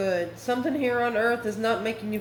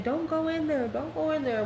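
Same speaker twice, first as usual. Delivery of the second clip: higher-pitched mock warning plea; acting out a viewer warning the screen; movie-watcher's warning; 6 semitones higher than usual. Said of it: "you're watching that movie, ... and you're saying".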